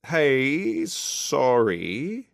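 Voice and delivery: high, tentative interruption in a character voice; pensive Emma. A narrator's reading. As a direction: pipe up apologetically, high and drawn out. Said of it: "Emma tiptoes pensively into the room".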